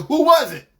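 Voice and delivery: dead serious. Retold or imitated. Boyfriend confronting his girlfriend, dead serious. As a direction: fire it off as an angry demand. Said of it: "dead serious".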